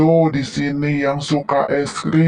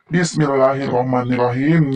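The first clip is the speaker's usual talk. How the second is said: ordinary talk, nothing marked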